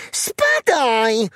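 high shouted shoo; piglet calling out. A narrator's reading. Piglet shooing the mosquito from his ear, high shout. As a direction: shout the pest away in a high voice. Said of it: "zawołał prosiaczek".

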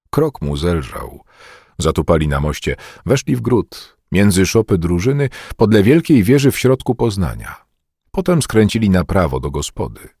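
ordinary delivery, nothing marked

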